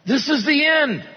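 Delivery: high cry of despair. Acted. Jeremiah crying out from the well, high and despairing. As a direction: cry out as if all is lost. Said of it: "And I cried out".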